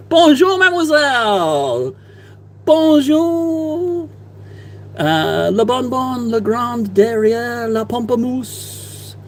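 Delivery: hammed-up French accent, pitched high and slowed; mock French. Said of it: "that's all my French".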